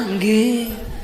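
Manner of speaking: drawn-out falling "nggih"; comply meekly, stretching the word; obedient wife. Retold or imitated. Solo wife answering husband, meek reply.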